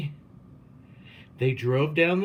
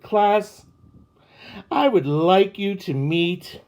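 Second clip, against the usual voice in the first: louder, higher announcement; announcing to class; 7 semitones higher, 7 dB louder than usual. A narrator's reading. Principal announcing the new teacher to the class, loud. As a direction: address the whole room.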